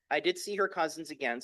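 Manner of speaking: ordinary talk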